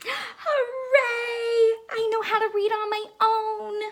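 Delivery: high, loud, fast delighted shout; excited cheer. Acted. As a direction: cheer like a thrilled kid. Child hero cheers in story.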